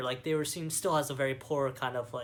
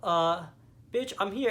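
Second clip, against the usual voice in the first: sassy mock comeback; 6 semitones higher, 6 dB louder than usual. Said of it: "she's like".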